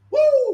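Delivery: sudden high whoop of delight; excited whoop. Real, not acted. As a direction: let out a joyful woo. Excited whoop mid-sermon.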